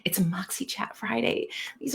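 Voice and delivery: soft breathy whisper of the show name. Real, not acted. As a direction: whisper it like a secret.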